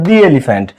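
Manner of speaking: 'the elephant' with "the" as "dee"; 'the' with a long ee vowel; correct "the". Shown as a demonstration. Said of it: "উচ্চারণটা হচ্ছে".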